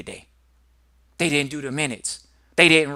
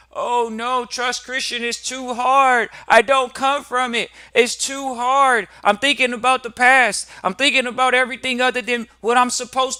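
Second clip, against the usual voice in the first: hammed-up complaining client, much higher and louder; mock whiny client; 10 semitones higher, 8 dB louder than usual. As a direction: whine like a client dodging the work. Mocking an excuse-making client.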